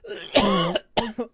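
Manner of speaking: hard choking cough; real coughing fit; coughing up water. Real, not acted. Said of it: "Water went down the wrong pipe".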